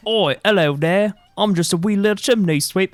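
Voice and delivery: high British toy chimney sweep; British accent. Acted. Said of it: "I give them all British accents".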